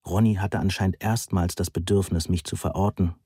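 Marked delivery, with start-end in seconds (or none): none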